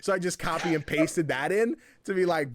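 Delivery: ordinary delivery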